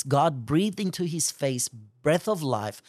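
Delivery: normal talking voice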